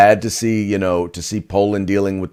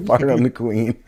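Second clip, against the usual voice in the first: laughing through the words; amused laugh on every word; 3 semitones higher than usual. Real, not acted. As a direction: repeat the line while cracking up. Cohost repeats the joke laughing.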